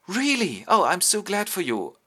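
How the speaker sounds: high-pitched, gushing reply; be thrilled for a friend; delighted surprise. Retold or imitated. Friend reacts to the news.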